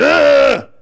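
exasperated grunt at an email; frustrated ugh. Retold or imitated. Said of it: "I don't know how to spell that".

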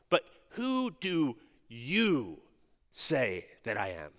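slow, weighty stress on "you" in "But who do you say that I am?"; "you" set apart, slow and pointed; emphatic you. Shown as a demonstration. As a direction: lean hard on "you", drawn out. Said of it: "record this with the emphatic".

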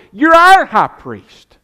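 booming, slowed, drawn-out declaration; loud slow proclamation. Real, not acted. Preacher to congregation, proclaiming Christ their high priest, booming and slow. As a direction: thunder it out from the pulpit.

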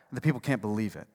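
usual speaking voice

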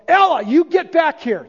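loud, high shout across the yard; sharp yell. Retold or imitated. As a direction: yell to stop a child running into the street. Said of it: "And I yelled".

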